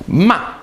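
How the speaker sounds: MA falling sharply from high; quick downward stab on MA. Shown as a demonstration. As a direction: say MA high, then drop it sharply. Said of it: "goes down sharply".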